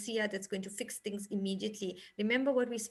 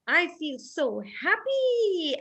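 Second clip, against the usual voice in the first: happy; 6 semitones higher, 7 dB louder, much slower than usual. Shown as a demonstration. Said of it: "you'd have to take the paper and say".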